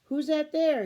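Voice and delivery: put-on loud, high, gruff German-accented demand. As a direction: bark out who's there. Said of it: "he demanded".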